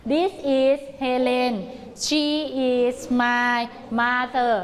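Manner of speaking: very slow, word-by-word; slow choral drill. Shown as a demonstration. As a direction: chant it slowly together. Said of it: "กลับมาใหม่นะ".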